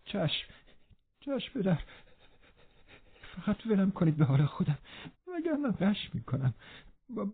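high, weak, breathless stammer; gasping, out of breath. A narrator's reading. Linton begs his father to leave him be, panting. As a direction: gasp it out in small frightened bursts, high and soft. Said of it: "لینتون نفس زنان گفت".

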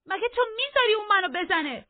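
very high, loud outcry; indignant shout. A narrator's reading. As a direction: cry out the question in protest. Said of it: "کاترین داد زد".

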